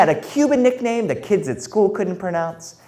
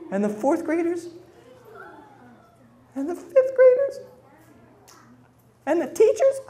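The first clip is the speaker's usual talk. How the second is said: rising panic. Acted. Building fear, pitch rising.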